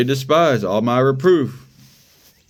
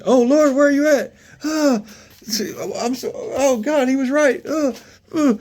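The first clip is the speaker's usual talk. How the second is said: desperate seeker; 13 semitones higher than usual. Acted. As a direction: wail like a lost sinner searching for God.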